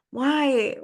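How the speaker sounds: high, pleading why; exasperated plea at the kid. Retold or imitated. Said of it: "I was like".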